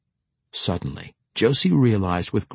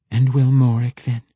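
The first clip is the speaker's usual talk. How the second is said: higher-pitched sarcastic jab; open sarcasm; 3 semitones higher, 4 dB louder than usual. A narrator's reading. Deli questioning Morik's promises, openly sarcastic. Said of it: "Deli asked with open sarcasm".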